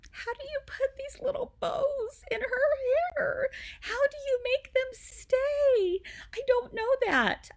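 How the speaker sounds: high, sobbing, loud crying retelling; tearful wail. Retold or imitated. New mom sobbing to the nurse, high crying plea.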